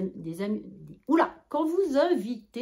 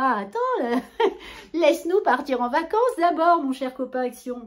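mock scolding; 5 semitones higher, 7 dB louder than usual. Scolding the store playfully.

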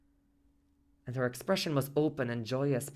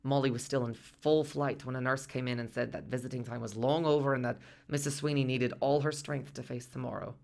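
ordinary delivery, nothing marked

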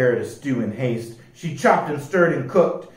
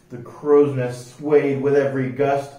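ordinary delivery

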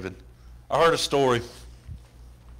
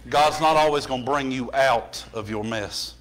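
ordinary talk

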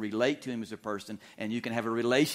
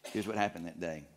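ordinary talk, nothing marked

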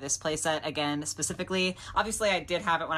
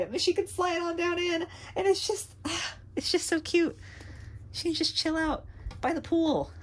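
cooing excitement; 12 semitones higher than usual. Real, not acted. Cooing over doll on slide.

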